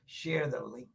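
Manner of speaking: low, hushed whisper to listeners; barely audible coaxing; whispered urging. Real, not acted. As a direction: whisper "Share the link" as a secret nudge. Same urging dropped to a whisper.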